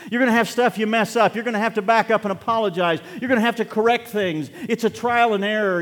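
high, loud preacher's exhortation; impassioned sermon at full volume; full-force preaching. Real, not acted. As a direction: preach it hard and high to the whole congregation.

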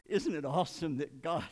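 ordinary talk, nothing marked

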